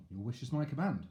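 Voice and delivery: ordinary delivery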